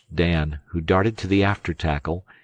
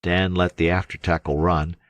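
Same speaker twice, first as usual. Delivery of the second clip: ordinary delivery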